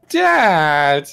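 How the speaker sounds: put-on high, loud protesting child; orc kids. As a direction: whine out a scandalised child's cry. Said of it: "the kids are like".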